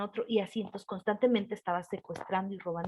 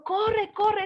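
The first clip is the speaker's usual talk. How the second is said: urgent yell, much higher and louder; retold shout; 11 semitones higher, 5 dB louder than usual. Older girl grabbing the witch, urging the child to run, loud yell. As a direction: shout a frantic warning to flee. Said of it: "y me gritó".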